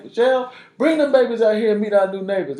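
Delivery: loud, high holler to his wife; calling out. Retold or imitated. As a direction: summon the family from outside, excitedly. Calling wife out to meet neighbors.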